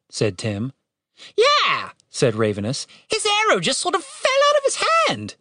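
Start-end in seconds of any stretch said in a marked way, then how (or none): 1.4-1.9 high falling squawk; sarcastic raven. A narrator's reading. Raven scoffs at Tim's excuse, sarcastic squawk. 3.1-5.4 very high mocking squawk; sarcastic raven. A narrator's reading. Raven ridicules Tim's accident claim, sarcastic.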